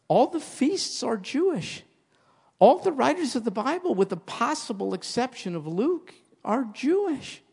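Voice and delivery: high, ringing pulpit voice; preaching with rising fervor; fervent preaching. Acted. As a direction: proclaim it to the congregation. Preaching at full force.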